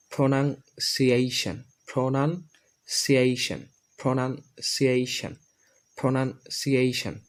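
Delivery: প্রনাউন্সিয়েশন in parts, "pronan-si-ay-shan", falling; new pronunciation. Shown as a demonstration. Teacher drills learners, প্রনাউন্সিয়েশন as "pronan-si-ayshan". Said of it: "এই চারটা উচ্চারণ করি".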